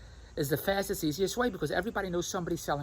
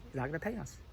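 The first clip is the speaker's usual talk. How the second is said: softer quoting of the wife; soft mimic; 2 semitones lower, 9 dB quieter than usual. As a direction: quote her doubtfully, quietly. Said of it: "why would Andrea say".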